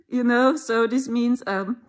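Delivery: usual speaking voice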